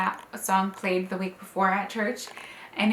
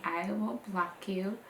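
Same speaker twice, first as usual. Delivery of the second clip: ordinary talk, nothing marked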